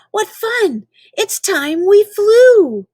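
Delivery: usual speaking voice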